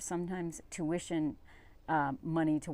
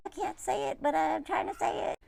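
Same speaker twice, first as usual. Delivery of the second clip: high, pitched-up pretend plea; mock trapped wife; 8 semitones higher than usual. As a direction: voice her secret message, pitched up. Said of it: "being like".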